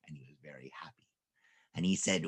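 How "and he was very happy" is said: hushed, sly whisper; softly whispered narration. A narrator's reading. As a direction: whisper it like a secret. Narrator hushes over cat.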